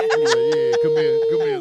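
gleeful friend luring you in; eager mock taunt. Mechanic friend eager to rip.

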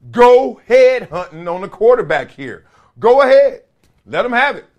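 rally the defense like a fired-up coach; hyped-up coach talk. Retold or imitated. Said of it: "he didn't say it so highbrow".

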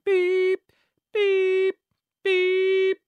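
high, loud sung beeps; radio news beeps. Shown as a demonstration. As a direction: imitate the news-coming beeps. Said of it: "That indicates the news is coming".

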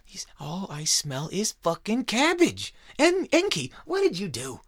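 hammed-up fed-up god raging at humans; disgusted god ranting. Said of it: "He was like".

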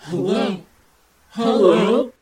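eerie ghostly phone voice; unearthly voice. Retold or imitated. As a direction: call out like a distant ghost. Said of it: "an eerie voice saying".

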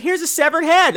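high-pitched cheery offering; daughter presenting. Acted. Daughter offers mother a head, high voice. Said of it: "So she's like".

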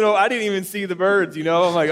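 ordinary delivery

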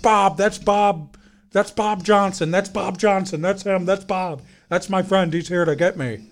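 high, loud, insistent repetition; imitated excited shouting. Man in the chair announces his friend has come, imitated excited shout. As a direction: mimic a man loudly overjoyed at seeing his friend.

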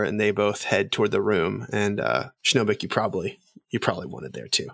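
normal talking voice

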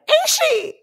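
high, shouted question in another voice. Retold or imitated. Friend retells the sister asking, shouted high. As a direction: call it out high, almost a shriek.

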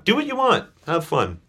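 high, carefree; breezy Cindy. Retold or imitated. Brother quoting his mentor shrugging it off, high and carefree. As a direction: toss it off lightly. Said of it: "she'd say".

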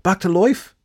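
high, loud hopeful question; surprised hope. A narrator's reading. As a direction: ask with sudden hope.

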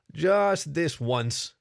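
slow, drawn-out warning; cautioning. A narrator's reading. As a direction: stretch it out as a gentle warning. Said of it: "he cautioned".

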